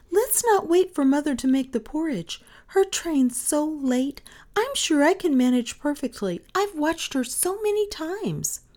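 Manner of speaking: put-on high-pitched child's pleading; eager little girl. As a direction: talk an idea up eagerly in a high girl's voice.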